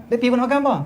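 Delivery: high-pitched cross comeback; angry retort. Acted. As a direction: act the friend answering back irritably. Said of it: "Irham pula kata". (lakonan membalas dengan marah)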